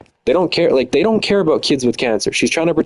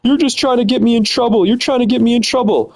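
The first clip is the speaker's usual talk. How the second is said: much higher mocking imitation; 11 semitones higher than usual. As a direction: parrot her accusation in a high sneer. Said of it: "She would say".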